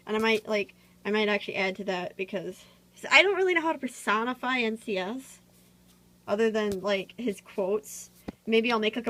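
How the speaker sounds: ordinary delivery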